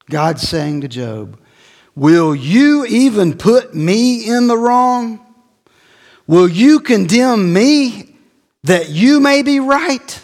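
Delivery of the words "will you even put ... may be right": much higher, louder and slow; rebuking God. Acted. God confronting Job, high, loud and slow. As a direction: draw out each word as a booming rebuke.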